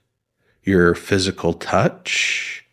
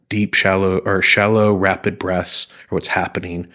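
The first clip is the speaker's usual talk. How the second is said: ordinary talk, nothing marked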